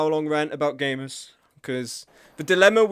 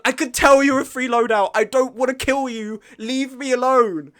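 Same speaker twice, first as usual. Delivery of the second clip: higher, loud exasperated begging; frustrated pleading; 7 semitones higher, 5 dB louder than usual. Real, not acted. Streamer begging enemy players to leave him alone, loud and higher. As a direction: beg them to go away.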